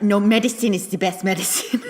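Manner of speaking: mock-frank comeback through a laugh; professor's dry retort, laughing. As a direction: toss it off flatly while cracking up. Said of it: "And he's like".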